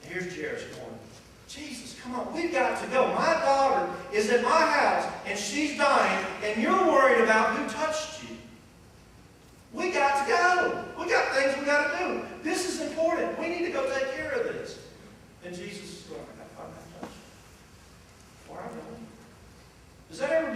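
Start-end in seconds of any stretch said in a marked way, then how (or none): 1.5-14.8 high, loud, pleading urgency; father pleading with Jesus to come now, urgent; urgent Jairus. Retold or imitated. Jairus urging Jesus to hurry. 16.2-19.1 soft, hushed reply; Jesus brushing off Jairus, hushed; quiet Jesus. Retold or imitated.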